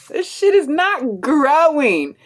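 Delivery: high, drawn-out protest; mock outrage. Daughter complaining to Dad it is not working, mock outrage. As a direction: wail the complaint slowly, hammed up. Continues acted protest after laughing.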